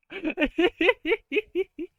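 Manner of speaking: high, loud staccato giggle; rapid high-pitched cackling laugh. Real, not acted. Streamer cracking up at their own dark joke, giggling.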